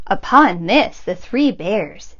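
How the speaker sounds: ordinary delivery, nothing marked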